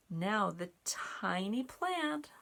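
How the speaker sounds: usual speaking voice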